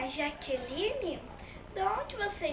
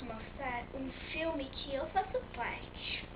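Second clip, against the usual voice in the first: whisper; 10 dB quieter than usual. Real, not acted. Aside before next segment.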